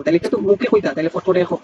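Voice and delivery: ordinary talk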